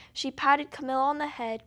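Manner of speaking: usual speaking voice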